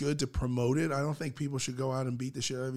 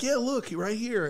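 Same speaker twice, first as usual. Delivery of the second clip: put-on eager girl; 7 semitones higher than usual. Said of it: "they're like".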